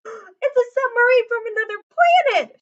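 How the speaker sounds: high, loud panicked shriek. A narrator's reading. Coach seeing the monster in the pool, shrieking. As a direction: shriek in terror at the thing. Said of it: "shrieked the coach".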